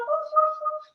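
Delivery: very high little dog barks; puppy woofs. Shown as a demonstration. Teacher playing dog for children, high woofs. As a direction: woof like a small dog, high-pitched. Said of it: "Downward facing dog".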